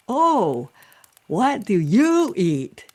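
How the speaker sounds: high, loud, drawn-out question; wide-mouthed frog. Acted. Frog innocently quizzing the heron, loud and wide-mouthed. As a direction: stretch every vowel open wide. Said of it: "trying to open my mouth really wide".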